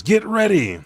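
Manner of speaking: high, loud rallying call; rally the fighters in character; shouted battle command. Retold or imitated. Loki readying allies against Imu, high shouted command.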